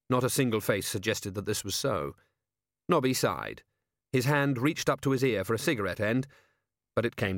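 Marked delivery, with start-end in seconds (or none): none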